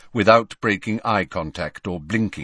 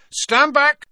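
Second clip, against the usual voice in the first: loud, very high order; shouted command; 7 dB louder than usual. A narrator's reading. Harry about to blast the door, shouted command. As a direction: yell a warning to clear the way.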